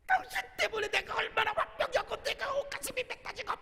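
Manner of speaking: high, squeaky mimicked wife; mock small voice. Pastor imitating his wife's tirade on the bus, mock high. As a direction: imitate her in a tiny high voice. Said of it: "자그마한 목소리로".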